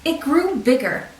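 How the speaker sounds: usual speaking voice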